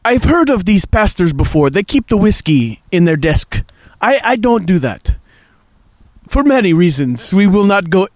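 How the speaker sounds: lightly put-on Russian accent; joking pastor in a mock Russian accent. Pastor jokes about whiskey desks, mild Russian accent. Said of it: "I'm gonna use my regular voice now".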